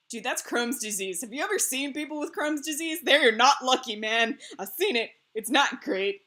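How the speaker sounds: loud, heated telling-off; indignant rant to the hairdresser about Crohn's disease; indignant retold rant. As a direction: set her straight, worked up. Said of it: "And I'm like".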